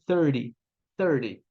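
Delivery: "thirty" as "thirdy", stress on R; soft R-heavy. Shown as a demonstration. Teacher showing the American "thirty". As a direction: lean on the R in "thirty". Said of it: "vai focar no R".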